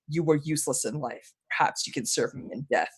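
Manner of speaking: low, hushed death sentence; soft-spoken dark lord. Acted. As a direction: pronounce doom softly, well below normal pitch. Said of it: "he speaks softly".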